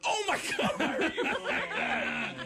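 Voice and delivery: very high-pitched mock dismay; aghast outcry. Comic appalled by his friend's answer, aghast. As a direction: wail it in comic disbelief, way up high.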